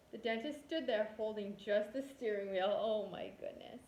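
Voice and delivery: ordinary delivery, nothing marked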